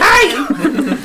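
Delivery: high, squeaky cartoon greeting; Toadette voice. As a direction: chirp it like a tiny mushroom girl. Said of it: "She sounds like Toadette".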